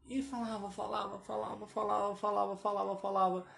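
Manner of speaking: high, unbroken monotone chain of "falava"; droning on and on. Retold or imitated. Telling of a stranger's endless chatter, droning. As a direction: repeat it on one high note without stopping. Mimicking the man's endless talking.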